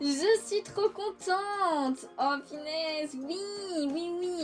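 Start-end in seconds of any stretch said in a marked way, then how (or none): 0.0-2.1 dreamy delight. Real, not acted. Repeat, slower.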